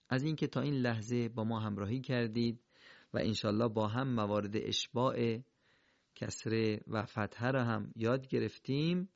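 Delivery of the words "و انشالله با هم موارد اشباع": ordinary talk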